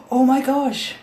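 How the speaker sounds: slow gasp, then high oh my gosh; shocked gasp. Real, not acted. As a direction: gasp at the filth. Dirt pours out.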